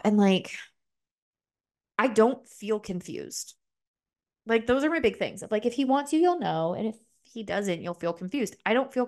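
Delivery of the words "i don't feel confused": usual speaking voice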